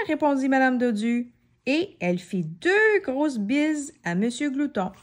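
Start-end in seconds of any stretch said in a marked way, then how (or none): none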